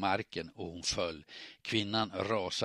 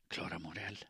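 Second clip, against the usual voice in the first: whispered forth; 9 dB quieter than usual. A narrator's reading. Woman confronts Klara at her door, whispered forth. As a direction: push the name out softly under your breath. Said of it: "kvinnan viskade fram".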